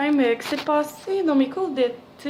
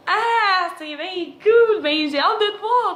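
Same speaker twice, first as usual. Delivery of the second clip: high, loud, drawn-out mock delight; squealing acted excitement; overjoyed; 8 semitones higher, 7 dB louder, slower than usual. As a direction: gush with hammed-up joy, breaking into a laugh. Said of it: "la joie sur mon visage".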